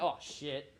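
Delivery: ordinary delivery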